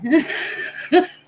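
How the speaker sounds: high, loud rising laugh. Real, not acted. Streamer laughing at her own Reaper joke, high laugh. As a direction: crack up again.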